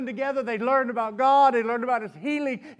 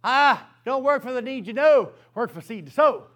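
firm preacher's refrain; preached saying again; 2 semitones higher, 5 dB louder than usual. Acted. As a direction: proclaim the motto louder. Preacher repeats key saying.

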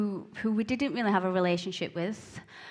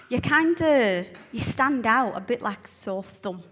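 usual speaking voice

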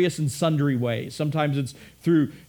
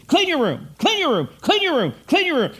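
much higher, louder, repeated "clean your room" in a rising nag; exasperated parent hammering it home; fed-up parent repeating the order; nagging parent; 12 semitones higher, 6 dB louder than usual. Retold or imitated. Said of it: "You tell your kid".

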